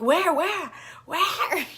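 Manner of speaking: high insistent repeated question; frantic mom. Retold or imitated. Mom pressing her for the location, a high frantic repeat. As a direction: press harder each time.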